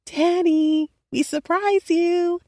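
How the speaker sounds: put-on high, excited little girl; tiny girl voice. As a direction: squeal like a small child. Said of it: "exclaimed in her tiny voice".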